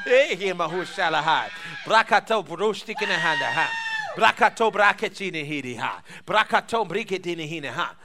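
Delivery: forceful repeated chant-like phrases, tailing down; speaking in tongues. Real, not acted. Preacher in worship after laughing, forceful speaking in tongues. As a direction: punch each opening, end on "ha".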